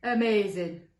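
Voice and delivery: loud, amazed monster; astonished character voice; mock gasp of amazement. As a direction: exclaim loudly in wonder. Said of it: "gasped the second monster".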